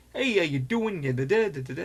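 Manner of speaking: hammed-up Boston accent hello. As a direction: do a quick upbeat Boston accent greeting. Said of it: "He kinda talks with a Bostonian accent".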